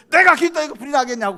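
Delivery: sudden loud, high outburst; acted indignant retort. Pastor denies the bar owner's accusation in court, loud indignant protest. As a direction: burst out like an accused man protesting.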